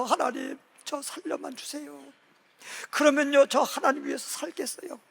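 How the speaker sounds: a mild weeping plea to God; half-sobbing prayer, voice rising high; tearful prayer retold. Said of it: "엉엉 울면서".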